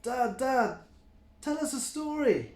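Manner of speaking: put-on high eager child voice; little kids begging their dad; excited kid goat. A narrator's reading. Kids pester dad for a bedtime story, high and eager. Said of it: "hopped about and said".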